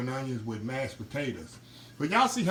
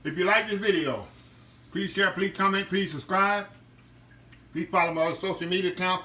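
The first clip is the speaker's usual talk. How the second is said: higher, brisk channel outro plug; upbeat sign-off; 3 semitones higher than usual. Acted. Host signing off to viewers, upbeat promo read. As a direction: rattle off the socials like an announcer. Signs off the cooking video.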